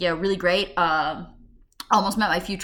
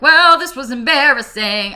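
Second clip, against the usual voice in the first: loud, slow, higher exclamation; mock embarrassment; 6 semitones higher, 10 dB louder, slower than usual. After waking a stranger's child, loud mock embarrassment. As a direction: announce it big and drawn out. Retold reaction after leaving.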